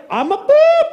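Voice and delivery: bleep word squeaked very high; mock outrage with a high-pitched bleep; indignant comeback. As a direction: throw the insult back in disbelief. Acted indignant comeback, bleeped insult.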